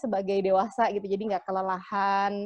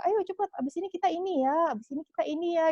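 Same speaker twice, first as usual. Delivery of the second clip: high, quick mock chivvying; imitated mom rounding up children; hurrying parent; 8 semitones higher, much faster than usual. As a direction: hustle the kids along in a raised pitch.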